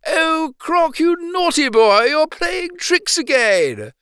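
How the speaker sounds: lady scolding her pet crocodile, high and fond. A narrator's reading. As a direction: chide him like a doting owner. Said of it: "She cried".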